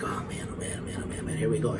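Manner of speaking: hushed, breathless oh man under the breath; whispered dread. Retold or imitated. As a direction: mutter it to yourself, dreading the moment. Nervous inner thought before toast.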